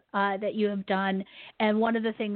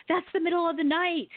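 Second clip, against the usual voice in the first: higher, mildly incredulous quote; retold surprise; 6 semitones higher than usual. Telling her guest what she told Lori, retold surprise. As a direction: imitate your own startled objection.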